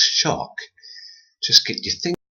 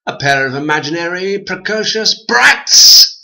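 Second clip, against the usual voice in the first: the man losing his temper with the twins, loud; 9 semitones higher, 8 dB louder than usual. A narrator's reading. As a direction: spit it out, fed up. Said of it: "losing his temper".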